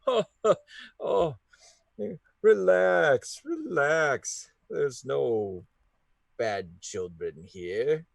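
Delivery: put-on high, loud cackling old man; sinister jolly stranger voice; creepy jolly voice. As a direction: greet with an unsettling jolly laugh. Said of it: "a voice go".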